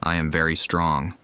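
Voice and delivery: normal talking voice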